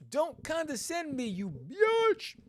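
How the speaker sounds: high, loud snapping retort; acted angry retort. Reading the heroine's insult to the villain, angry high retort. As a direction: throw the insult back at him.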